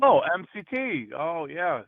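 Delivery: high, sudden "oh" of recognition; catching on all at once; surprised realization. Real, not acted. Suddenly recognizing what she means.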